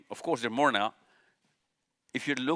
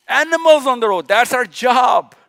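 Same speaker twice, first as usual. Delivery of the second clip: preaching shout; 5 semitones higher, 6 dB louder than usual. Real, not acted. Preacher shouting at listeners that helping animals is their duty. Preacher hammering his point.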